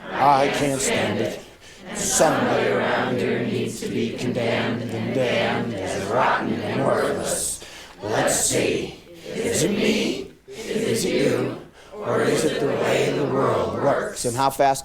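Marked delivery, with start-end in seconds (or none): 0.0-11.6 chanted, slow read-aloud; reading out loud together like a chant; slow group recitation. Shown as a demonstration. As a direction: lead the class through it slowly. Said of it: "read that sentence out loud".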